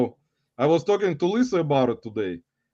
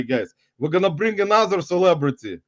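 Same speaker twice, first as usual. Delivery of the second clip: normal talking voice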